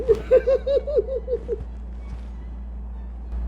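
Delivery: high airy unvoiced royal laugh; diva laugh. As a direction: laugh grandly like a spoiled queen. Queen's laugh.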